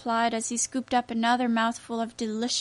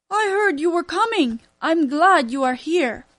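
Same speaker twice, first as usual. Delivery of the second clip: put-on loud welcoming old man; hearty grandfather; 4 semitones higher, 6 dB louder than usual. A narrator's reading. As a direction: greet the boy heartily. Grandfather greets Charles.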